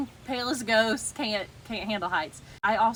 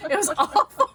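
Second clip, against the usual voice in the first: laughing; 8 dB louder than usual. Real, not acted. Laughing about the ride.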